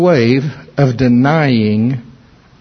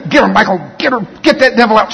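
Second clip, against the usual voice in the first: urgent cheering on; 7 semitones higher, faster than usual. Retold or imitated. Urging Michael during deliverance.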